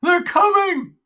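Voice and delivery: high, loud panicked cry; a terrified old man crying out; crying out in fear. Acted.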